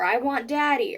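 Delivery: ordinary delivery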